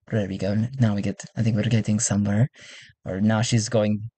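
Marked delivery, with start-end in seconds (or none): none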